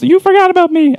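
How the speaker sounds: very high put-on voice; mock complainer. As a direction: squeak it like someone playfully left out. Voices an imagined forgotten member.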